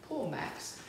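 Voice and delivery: usual speaking voice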